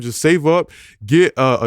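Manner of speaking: normal talking voice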